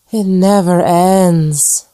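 dragged out in endless despair; moaned. Acted. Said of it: "moaned again".

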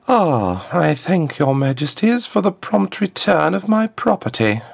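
high, smooth voice of a formal diplomat; a smooth ambassador, pitched well up; smooth contralto ambassador. A narrator's reading. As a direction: address royalty smoothly in a high-set voice. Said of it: "her smooth contralto echoing in the hall".

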